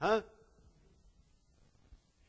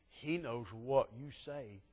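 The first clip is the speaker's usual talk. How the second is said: low confiding hush, getting softer; hushed warning; 5 semitones lower, 10 dB quieter than usual. Retold or imitated. Servant quietly warning the king of his spy. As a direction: lean in and tell the secret softly.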